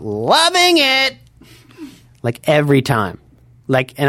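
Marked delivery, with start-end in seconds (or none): 0.0-1.2 high, slow, drawn-out mock yell of loving it; drunk fan scream. Wasted girls at the Hollywood Bowl yelling after songs, high drawn-out mock scream. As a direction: stretch it out like a tipsy concertgoer. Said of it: "they would scream out".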